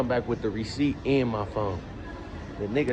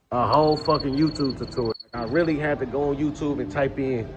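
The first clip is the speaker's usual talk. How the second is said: usual speaking voice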